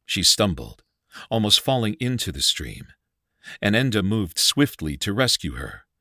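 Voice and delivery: usual speaking voice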